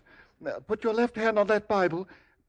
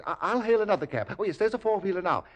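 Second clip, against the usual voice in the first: ordinary talk, nothing marked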